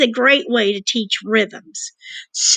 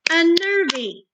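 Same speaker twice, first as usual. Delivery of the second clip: raised pitch; 6 semitones higher than usual. Shown as a demonstration. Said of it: "There's three sounds".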